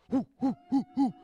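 loud, high hooting cheer; rowdy whooping. Acted. Philistines behind Goliath cheer him on, loud high whooping. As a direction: whoop like a crowd behind its champion.

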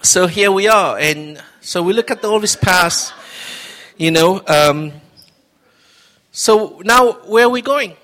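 fervent preacher building to a question; rousing preaching. Acted. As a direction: lift the room, preach it out. Preaching to congregation.

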